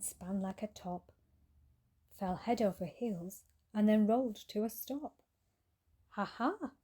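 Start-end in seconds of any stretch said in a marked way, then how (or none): 6.1-6.7 high little mocking laugh; a tiny flea laughing at the cat; tiny teasing flea. A narrator's reading. Flea taunting the cat, tiny and teasing. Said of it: "said a voice, all tiny and teasy".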